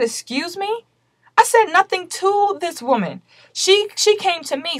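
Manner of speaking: high-pitched disbelief, drawn out; stunned at the accusation, voice jumping up; shocked disbelief. Retold or imitated. To the police officer accusing her, shocked high disbelief.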